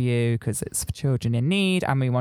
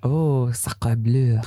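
put-on French accent; much slower than usual. Mock French reply.

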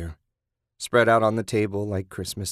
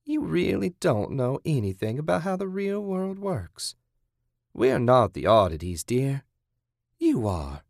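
lightly voiced scornful captor; condescending captor; 6 semitones higher than usual. Kathy correcting her captive, condescending. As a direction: talk down to the captive.

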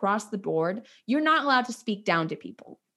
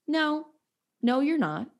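imitated talking-down tone, pitched higher; condescending no; 6 semitones higher than usual. Ballet woman correcting the nanny, condescending. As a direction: put on a dismissive, higher-pitched refusal. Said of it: "like that to me".

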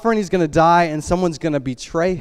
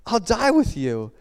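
higher, slow pledge of loyalty; earnest vow; 6 semitones higher, slower than usual. Acted. Peter vowing to Jesus, earnest and insistent. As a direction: swear it slowly, pitched up.

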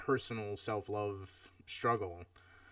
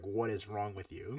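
usual speaking voice

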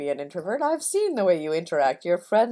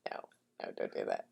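whisper; 15 dB quieter, much faster than usual. Real, not acted. Friend protests the silly idea.

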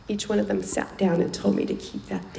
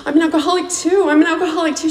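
emotional high outburst; 10 semitones higher, 9 dB louder, faster than usual. Retold or imitated. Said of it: "I was like".

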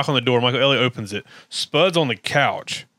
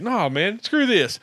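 higher, mock fed-up quote; acting out Corky; 6 semitones higher, slower than usual. Corky facing the locked living room, higher mock defiance. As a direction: imitate a friend refusing to put up with it. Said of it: "Corky's like".

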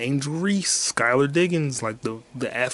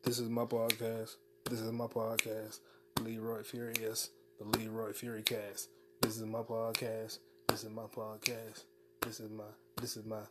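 much softer, almost under the breath; very quiet outro; 16 dB quieter than usual. Real, not acted. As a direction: murmur it to yourself after signing off. Muttered outro after sign-off.

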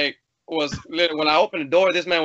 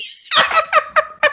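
high laugh; 6 dB louder than usual. Real, not acted.

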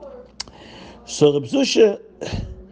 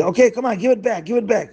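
higher, pushy mock demand; demanding crowd; 9 semitones higher, faster than usual. Townsfolk pressing Zusha for the money, mock angry. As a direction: press him to hand it over.

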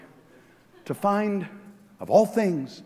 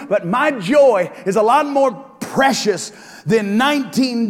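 higher, louder shouted preaching; preaching at full force; 10 semitones higher, 9 dB louder than usual. Acted. Pastor challenging the congregation, preaching full force. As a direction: drive it home to the church.

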